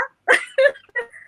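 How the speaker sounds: a loud, high laugh breaks out; high burst of laughter. Real, not acted. She laughs out loud recalling her father's change. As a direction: laugh high and loud at how absurd it was.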